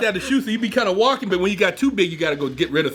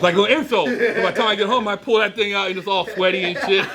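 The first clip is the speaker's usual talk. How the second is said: telling it through laughter, a bit higher; laughing; 5 semitones higher than usual. Real, not acted. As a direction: laugh as you finish the story.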